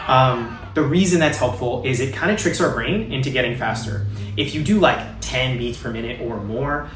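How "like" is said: ordinary delivery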